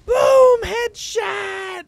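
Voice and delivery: very high, slow screamed catchphrase; hammed-up triumphant gamer scream; gamer catchphrase yell. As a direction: stretch it into a falsetto shout.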